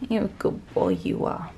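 hushed, soothing whisper to a pet; soft whisper. Real, not acted. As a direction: whisper praise gently to the kitten. Soothing the kitten softly.

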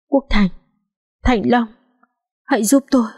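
faint, halting, rising last words; dying stammer. A narrator's reading. Dying woman calling for help, weak stammering. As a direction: struggle out each name with her last breath. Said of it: "cô lắp bắp".

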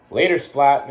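plain reading voice

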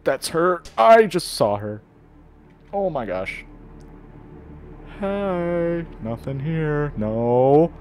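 loud high frightened cries; panicked. Real, not acted. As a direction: react in terror as she appears. Sees monster, panics.

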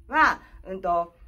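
usual speaking voice

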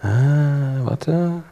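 ordinary talk

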